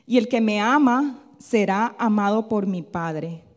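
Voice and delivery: slow, loud, deliberate verse reading; scripture reading. Acted. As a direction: read it out firmly with weighty pauses. Reads the Bible verse aloud. (lectura bíblica lenta, fuerte y solemne)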